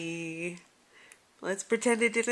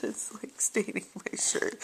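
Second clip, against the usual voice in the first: disgusted whisper; hushed and grossed out, breathy whisper; 9 dB quieter than usual. Real, not acted. Baby slobbers on shirt.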